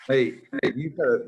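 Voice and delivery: ordinary talk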